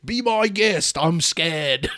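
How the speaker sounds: high, loud put-on British voice; hammed-up British bandmate; mock Liverpool accent, comically strained. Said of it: "it's called [name] and [name]".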